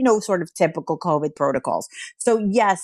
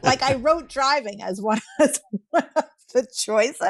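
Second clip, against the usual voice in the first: talking through a laugh, higher pitched; amused laughing; 6 semitones higher than usual. Real, not acted. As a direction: laugh your way through the words. Amused at survey answers.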